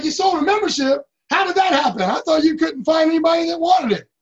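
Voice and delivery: can't believe what he's hearing; amazed disbelief. Retold or imitated. Boss reacting to the first sale, loud and amazed. Speaker's retold amazed reply.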